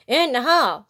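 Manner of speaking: loud, high shout. A narrator's reading. SpongeBob shouting the rules at the class, loud shout. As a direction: bellow the rule out. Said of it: "SpongeBob shouted".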